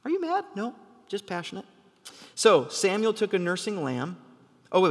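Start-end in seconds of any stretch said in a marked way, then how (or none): 0.1-0.4 mock wife, much higher; a light high voice for the wife; wife asking. Imitating his wife asking if he is mad, high. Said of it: "That's what I tell my wife". 0.6-1.6 soft, quick reply; his own reply. Retold or imitated. Answering his wife, soft and quick. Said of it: "That's what I tell my wife".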